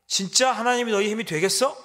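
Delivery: enemy jeering harder at their God; sharper mocking taunt. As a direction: escalate the sneer, leaning on 진짜. Assyrian mockery, intensified.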